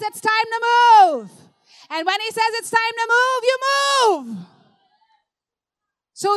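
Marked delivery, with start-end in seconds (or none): 0.1-1.3 a loud, raised call with a drawn-out MOVE; loud command. Acted. A voice calling the waiting church to act, loud command. God's command, preached emphatically. 2.6-4.5 raised, loud urging; emphatic command. Acted. Preacher urging the church to move when told, emphatic command. Repeats God's command to congregation.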